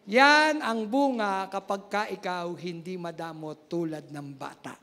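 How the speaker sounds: normal talking voice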